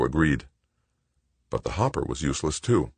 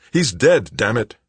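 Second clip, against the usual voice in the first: loud, high distraught retort; desperate, exasperated cry; frantic outburst; 7 semitones higher, 6 dB louder than usual. A narrator's reading. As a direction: burst out in alarm, then drop slightly. Frantic Paul answers Tinker.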